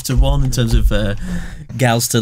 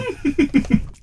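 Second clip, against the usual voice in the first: high giggle; 13 semitones higher, 4 dB quieter than usual. Real, not acted. Laughing mid-story.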